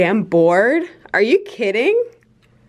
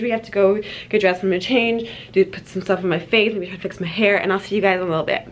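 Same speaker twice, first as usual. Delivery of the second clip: ordinary talk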